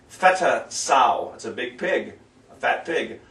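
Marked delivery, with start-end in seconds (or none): none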